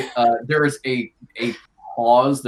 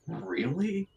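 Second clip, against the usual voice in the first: very soft, slightly raised question; hushed question; 4 semitones higher, 12 dB quieter than usual. Acted. Carmine answers a villager's whisper in her ear, hushed. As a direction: ask it under your breath.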